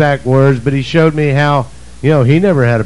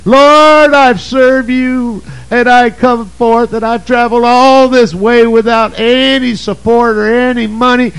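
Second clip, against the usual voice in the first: high self-pitying wail; 7 semitones higher than usual. Retold or imitated. Preacher mocking his own pity party, high self-pitying wail. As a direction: wail your woes to the Lord like a pity party. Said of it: "I was having a grand pity party".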